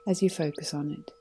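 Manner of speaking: calm, unhurried meditation guide voice; guided meditation. Acted. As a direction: lead them gently into stillness.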